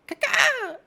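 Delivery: high squeaky bird chirp; bird call. Retold or imitated. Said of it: "A chirp".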